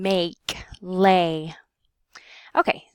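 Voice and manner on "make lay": "Make, lay" with a long, open E; drawn-out open vowel in "Make" and "lay". Shown as a demonstration. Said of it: "your jaw will drop some".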